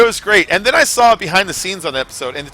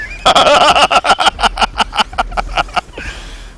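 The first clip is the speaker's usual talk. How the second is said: laugh; 8 semitones higher, 4 dB louder than usual. Real, not acted. Laughing at the Star Trek moment.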